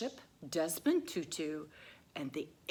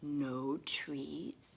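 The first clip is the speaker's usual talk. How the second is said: usual speaking voice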